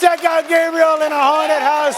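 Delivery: loud, high promotional sign-off; announcer. Real, not acted. Host plugging guest's movie to the crowd, announcer shout. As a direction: belt out the plug over the applause.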